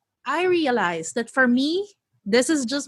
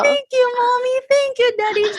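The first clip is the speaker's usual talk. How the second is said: put-on high, louder little-kid thanks; imitating the grateful kid; 12 semitones higher, 5 dB louder than usual. Kid thanking mom and dad, high and emotional. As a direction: gush it like a tearful happy child.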